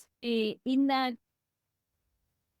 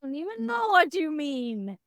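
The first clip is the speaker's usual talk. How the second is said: mock disbelief; 4 semitones higher than usual. Mock disbelief at her old self.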